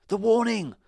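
high loud warning cry; cry of alarm. A narrator's reading. Pirate crying out at the whistle. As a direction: shout the alarm. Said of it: "one of them cried".

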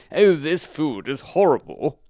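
snooty complaint about the meal; rude. A narrator's reading. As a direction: Town Mouse turning up its nose. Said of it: "said rudely".

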